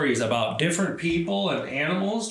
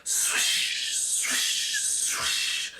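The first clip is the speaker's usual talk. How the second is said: soft fading swishes; whispered swish; 4 dB quieter, much slower than usual. Acted. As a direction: hush it like leaves. Leaves sound retold.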